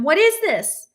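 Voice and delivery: put-on a frightened girl crying out at the glowing ooze; panic cry. A narrator's reading. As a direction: cry out in sudden fear. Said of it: "Kara gave a panic cry".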